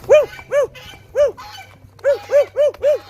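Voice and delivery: high, loud woo-woo to get the bird flying; loud falsetto hooting; excited cheering. Real, not acted. Urging bird to fly.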